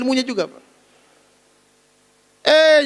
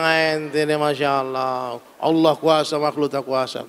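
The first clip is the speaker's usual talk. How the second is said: preachy reply to a convert; 10 semitones lower, slower than usual. Retold or imitated. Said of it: "Saya bilang".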